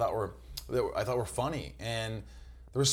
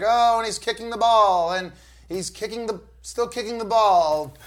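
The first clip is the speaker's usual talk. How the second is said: much higher and louder, hammed-up bored play-by-play; mock soccer commentator; 12 semitones higher, 12 dB louder, slower than usual. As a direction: parody a dull soccer commentator, loud and high, drawn out. Mocking boring soccer play-by-play.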